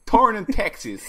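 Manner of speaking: loud, high silly voice; punchline in a goofy voice; mock voice. Punchline in put-on voice.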